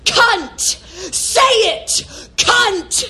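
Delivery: high, punched single-word chant; imitated yelling. Mother re-enacting daughter yelling at her, high insistent mock yell. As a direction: mimic a yelled demand, each word separate. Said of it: "She started yelling the word".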